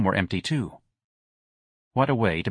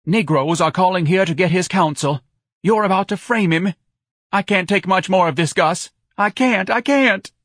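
high, loud tirade climbing higher; pitched-up, overwrought accusation; hysterical wife; 10 semitones higher, 5 dB louder than usual. A narrator's reading. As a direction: wail it, building to a breaking point.

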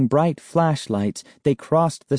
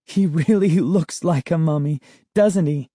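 laughing; 6 semitones higher than usual. Acted. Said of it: "Sari laughed".

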